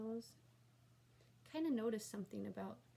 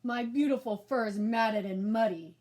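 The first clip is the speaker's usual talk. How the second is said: much louder rumbling monster voice; monster rug; 11 dB louder than usual. A narrator's reading. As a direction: loom over the kids as the rug. Talking rug monster speaks.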